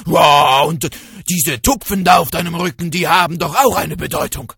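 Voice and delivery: loud, fast impressed tiger; awed tiger. Acted. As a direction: blurt it out, impressed and uneasy. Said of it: "sprach er". (staunender, lauter Tiger)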